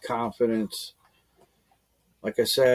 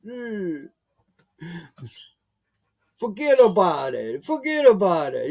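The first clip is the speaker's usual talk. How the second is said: higher mock mobster, forget about it; gangster voice; 6 semitones higher, 4 dB louder than usual. Imitating gangsters from old shows he watched. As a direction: ham up the old gangster catchphrase. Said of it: "that's how they talk".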